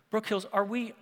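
ordinary talk, nothing marked

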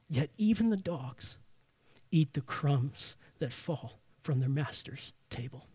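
soft, low reading of a humble reply; humble plea. A narrator's reading. Woman answering Jesus about the crumbs, humble and hushed. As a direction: read her answer quietly, letting it drop.

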